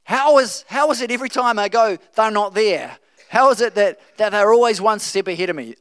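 the king rants that the enemy is always ahead; exasperated king. Retold or imitated. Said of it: "He's like".

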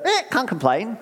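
a hint of the parrot. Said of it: "I've got a bit of".

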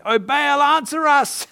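high, loud desperate cry to a god; shouting. Acted. Prophets calling on Baal for an answer, shouting.

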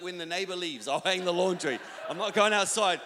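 loud, insistent refusal, building to a shout; stubborn refusal. Retold or imitated. Husband refusing wife's laundry request, loud comic stubbornness.

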